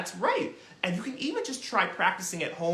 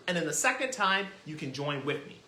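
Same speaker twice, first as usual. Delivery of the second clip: normal talking voice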